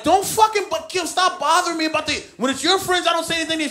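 husband shouting at his wife Kim; angry yell. Retold or imitated. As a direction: yell at her to stop nagging.

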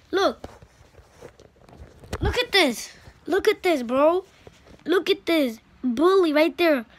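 high, loud insistent yelling; loud shouting. Real, not acted. Kid pointing out a bully, yelling at viewers. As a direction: shout to make them look.

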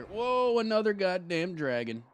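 high, loud startled cry at a new dragon; startled whoa. Real, not acted. Gamer spotting another dragon, startled and loud. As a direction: jolt up in surprise, then groan it out. Dragon suddenly appears in game.

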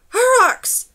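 very high, loud alarm shout; yelled warning. A narrator's reading. Girl alerting her companion to attackers, full yell. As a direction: yell a warning across the bridge. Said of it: "she yelled to Numer".

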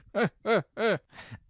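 loud, high hearty laugh; king's laugh. Acted. King laughs at talk of a giant, hearty laugh.

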